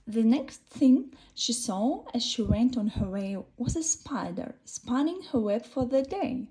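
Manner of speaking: ordinary delivery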